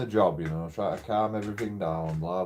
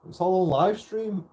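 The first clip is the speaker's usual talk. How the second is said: retold triumph; 10 semitones higher, 6 dB louder than usual. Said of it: "I'm like".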